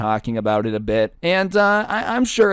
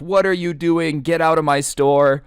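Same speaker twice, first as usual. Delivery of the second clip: raised, higher retold yelling; half-shouted order to leave; mock angry yell; 6 semitones higher, 3 dB louder than usual. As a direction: imitate an irate store owner. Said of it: "he starts yelling immediately".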